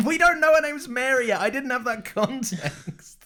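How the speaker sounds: loud, high-pitched indignant protest; exasperated comic outburst; laughing protest. Real, not acted. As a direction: protest loudly through laughter, stretching the end. Mock-outraged dispute over misheard name.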